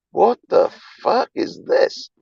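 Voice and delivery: high, slow, stretched-out disbelief; mock disbelief. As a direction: act amazed that anyone likes you. Retells his disbelief at popularity.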